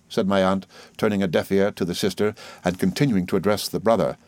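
ordinary delivery, nothing marked

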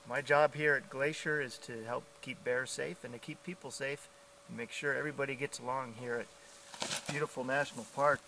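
usual speaking voice

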